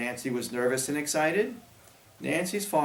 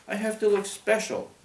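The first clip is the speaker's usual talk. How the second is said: put-on high insistent girl voice; 8 semitones higher than usual. Grace insisting after sneeze.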